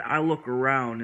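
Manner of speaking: ordinary delivery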